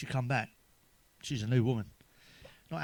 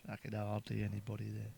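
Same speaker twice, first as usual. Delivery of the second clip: much softer aside, "hardly" leaned on; muttered repeat of the pun; 4 semitones lower, 12 dB quieter than usual. Shown as a demonstration. Comic mutters the Ardley pun again much softer. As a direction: repeat the punchline under your breath.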